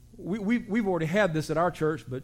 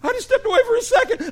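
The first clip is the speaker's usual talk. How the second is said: mock crying; 7 dB louder, faster than usual. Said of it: "started crying".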